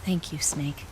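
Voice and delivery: soft breathy whisper of thanks. Acted. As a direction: breathe it quietly to him.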